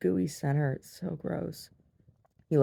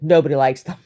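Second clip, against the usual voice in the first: much louder mock outburst; exasperated mom; 14 dB louder than usual. Mom after child's bite, loud mock exasperation. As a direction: burst out as the fed-up mother.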